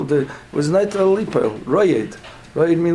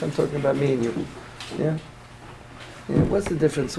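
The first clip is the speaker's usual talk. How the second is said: normal talking voice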